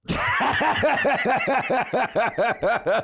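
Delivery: high bursting laugh; big laugh. Real, not acted. Laughing at McDonald's punchline.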